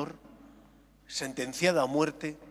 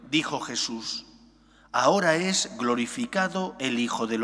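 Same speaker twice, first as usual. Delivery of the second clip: solemn Gospel proclamation, louder; liturgical reading; 2 semitones lower, 4 dB louder than usual. Acted. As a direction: proclaim it from the pulpit. Priest proclaims the Gospel aloud.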